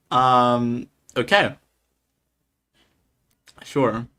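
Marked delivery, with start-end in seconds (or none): none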